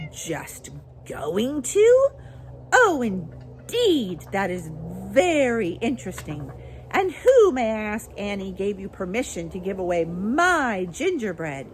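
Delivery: fierce, terrifying. A narrator's reading. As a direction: draw it out in mock-sweet fury. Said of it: "in a soft, fierce, terrifying voice".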